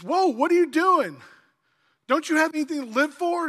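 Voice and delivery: loud, high, urgent questioning; alarmed calling out. Acted.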